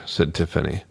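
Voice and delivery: usual speaking voice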